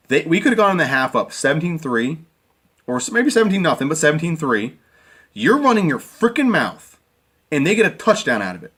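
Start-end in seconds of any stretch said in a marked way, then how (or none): 5.3-7.0 angry outburst. Real, not acted. Ranting at player.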